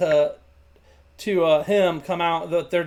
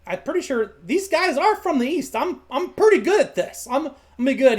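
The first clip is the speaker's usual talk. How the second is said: high-pitched, singsong youngster's voice; Junior, eager and confident; 7 semitones higher, 3 dB louder than usual. Acted. Junior translating goblin for the party, high and confident. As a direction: pipe up high, sure of yourself. Junior answers as a game character.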